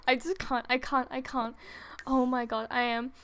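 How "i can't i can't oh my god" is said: normal talking voice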